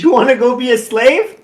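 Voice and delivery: high, loud mocking tease; joking. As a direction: needle him with a playful jab. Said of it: "She says it jokingly".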